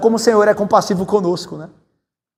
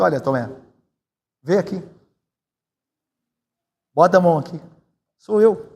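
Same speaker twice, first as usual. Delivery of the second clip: gentle and tender; 3 semitones lower than usual. Shown as a demonstration. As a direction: coax a friend kindly, hushed. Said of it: "eu imagino que o Senhor tenha dito".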